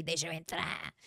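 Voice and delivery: low, hushed mock horror voice; spooky stranger at the door, played for laughs; softly hammed-up horror-movie line; horror-film plea. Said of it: "coisa de terror mesmo".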